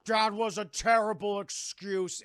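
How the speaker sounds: loud, slow, accusing mock voice; hammed-up indignation; mock indignant. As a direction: accuse slowly, leaning on each word. Fred confronts Jean, acted.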